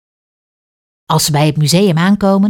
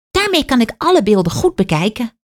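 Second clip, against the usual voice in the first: put-on higher child voice, eager; little girl; 7 semitones higher than usual. A narrator's reading. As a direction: play the girl explaining her glasses, pitched up. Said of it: "zegt Kaat".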